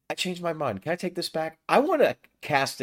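high, eager take-back; excited change of mind. Real, not acted. Player eagerly retracting his spell choice. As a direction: blurt it out, suddenly inspired.

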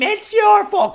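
very high, drawn-out screech; imitated yelling woman. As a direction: mimic a woman screeching an accusation. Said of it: "She was yelling at him".

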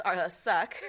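giggling through "suck"; laughing. Real, not acted. Laughs while slagging a brand.

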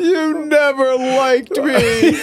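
fake-sob while laughing; hammed-up crying pony; mock crying. Joining the fake-crying bit.

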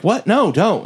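high-pitched mock protest; retold alarm. Friends object to Mia's risky plan, retold alarm. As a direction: imitate friends blurting out an objection.

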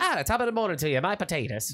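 earnest attempt at an Irish accent; practised Irish accent. Shown as a demonstration. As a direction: copy a stage-Irish brogue, badly. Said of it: "I'm gonna start working on my".

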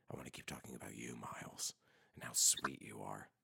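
hushed, fast playful whisper; mock-intimate whisper. Host teasingly flattering his guest Miles, mock-intimate whisper. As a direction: whisper sweet nothings, hammed up.

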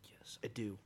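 breathy self-reassuring whisper. Retold or imitated. Nervous racer on the start line whispering to himself. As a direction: hush it to yourself, almost no voice.

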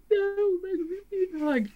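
hammed-up grief wail; mock wail. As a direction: wail it like a heartbroken fan, hammed up. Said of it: "we kept going, like".

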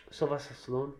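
ordinary talk, nothing marked

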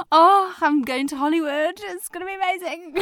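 high, hammed-up starry-eyed gush; giddy daydream. Actress imagining Hollywood after praise, hammed-up giddy. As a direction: send up your own naive excitement.

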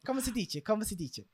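soft Italian phrase; Italian accent. Pretend. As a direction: say it like a real Italian. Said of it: "It's hard to do an Italian accent".

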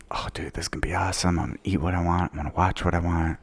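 low, soft, quick murmur; hushed self-quote. Retold or imitated. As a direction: imitate your own private plotting, under your breath. Acting out his home-alone glee.

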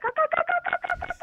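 very high squawking caw caw; shrill-free high bird squawk, sung out; bird call imitation. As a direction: mimic a jungle bird, high and sharp. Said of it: "it was like".

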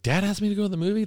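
high, quick, mock-astonished question; imitating amazed kid. Kid invited to the movies by dad, imitated amazement. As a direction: blurt it like a kid who can't believe it. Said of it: "that blow the kid's mind".

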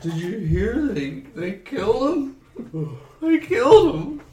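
a tiny shocked bear in grief; squeaky bear voice. Acted. Bear mourning killed bears.

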